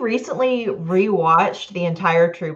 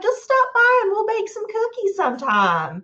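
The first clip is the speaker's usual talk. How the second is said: sweet high voice; 14 semitones higher than usual. Retold or imitated. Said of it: "And she was like".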